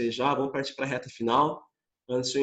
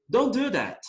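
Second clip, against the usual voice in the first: firm order, higher pitch; commanding order; 7 semitones higher than usual. Shown as a demonstration. Teacher demonstrating an order to students. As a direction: give a sharp command. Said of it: "Agora eu vou ordenar alguma coisa".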